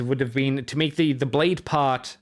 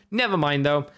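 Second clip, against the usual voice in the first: ordinary delivery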